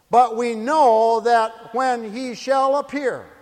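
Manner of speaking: loud, slow, drawn-out scripture reading; declaimed scripture. Real, not acted. Preacher quoting John to the congregation, loud slow proclamation. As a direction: proclaim each phrase to the whole congregation.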